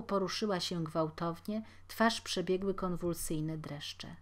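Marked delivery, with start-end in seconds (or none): none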